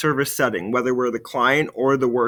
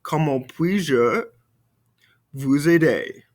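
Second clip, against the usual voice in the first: model phrase; slower than usual. Shown as a demonstration. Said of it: "Répétez-moi".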